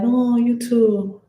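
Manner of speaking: high, slow sarcastic reply; sarcastic comeback. Retold or imitated. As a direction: drag it out, throwing it back at her. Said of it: "I'm like".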